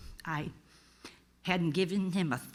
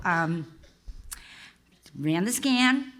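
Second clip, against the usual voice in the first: ordinary talk, nothing marked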